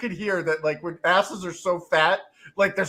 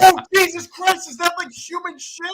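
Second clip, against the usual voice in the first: higher and louder, recoiling in disgust; disgusted outburst; 10 semitones higher, 6 dB louder than usual. Retold or imitated. Roommate smells the couch, disgusted higher outcry. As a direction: blurt it as the smell hits. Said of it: "I'm like".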